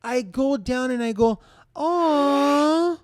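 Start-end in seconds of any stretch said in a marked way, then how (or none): 1.8-3.0 put-on high, loud cooing aw at a baby bump. Reaching for a friend's belly, a high cooing aw. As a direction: coo it like greeting a baby.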